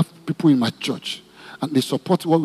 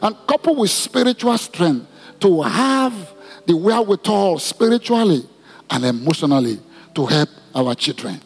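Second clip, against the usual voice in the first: leading prayer; 3 semitones higher than usual. Acted. Pastor leads congregation praying for mothers, fervent prayer. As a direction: lead the church in prayer for mothers and children.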